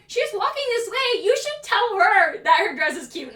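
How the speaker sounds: high, pointed retold reply; acted insistent comeback; insistent retort.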